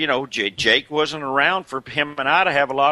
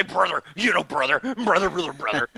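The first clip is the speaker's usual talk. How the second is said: higher, hammed-up brother chant; Hogan impression; 5 semitones higher than usual. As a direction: parody the wrestler's catchphrase. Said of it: "than Hogan can say".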